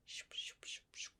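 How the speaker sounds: very soft breathy shoop effects; whispered shoop sounds. Shown as a demonstration. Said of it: "It really depends on the A S M R artist".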